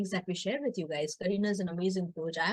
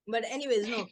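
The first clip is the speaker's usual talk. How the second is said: ordinary talk, nothing marked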